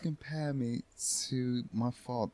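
quiet line practice; rehearsing under breath. Acted. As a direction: run the line softly to yourself. Actor privately practises line under host.

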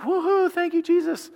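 high, giddy cheer of relief; mock celebration. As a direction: throw your hands up and cheer. Mock celebrating after God delivers.

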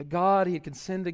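ordinary talk

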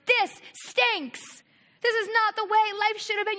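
acted anger on someone's behalf; acted raging. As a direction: protest it in shared outrage. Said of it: "I'm gonna rage with you".

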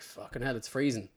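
soft muttered complaint about the cold; muttered curse. Retold or imitated. Freezing outside at night, a soft muttered curse. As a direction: grumble it under your breath.

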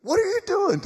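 high, loud, incredulous question; shocked outcry. Acted. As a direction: cry out in mock alarm at the risen man. Friends ask Lazarus once more.

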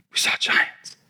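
We saw giants whispered, no voice. Retold or imitated. Spies reporting giants.

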